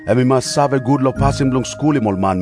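normal talking voice

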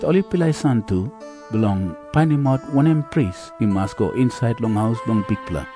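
ordinary delivery

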